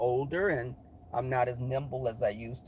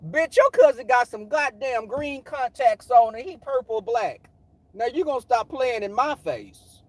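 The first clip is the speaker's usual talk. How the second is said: high, loud comic outrage; hammed-up indignation; 7 semitones higher, 7 dB louder than usual. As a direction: tell the homegirl off, exasperated. Indignant retort to homegirl, acted out.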